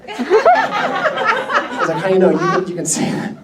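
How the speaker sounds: laughing while answering; laughing through words. Real, not acted. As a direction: chuckle the reply out. Laughing at daughter's joke.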